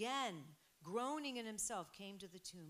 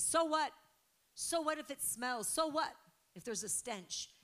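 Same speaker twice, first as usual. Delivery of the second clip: shouted, much higher, scoffing; loud defiant so what; 6 semitones higher, 8 dB louder than usual. Real, not acted. Preacher to church, shouting a defiant so what. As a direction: brush the objection off loudly.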